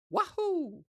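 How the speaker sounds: very high cartoon plumber cheer; Mario voice. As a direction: squeal it like the game hero jumping. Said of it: "how do you know what his voice sounds like".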